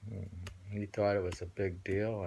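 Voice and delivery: usual speaking voice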